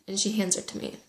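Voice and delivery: usual speaking voice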